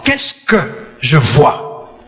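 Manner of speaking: ordinary talk, nothing marked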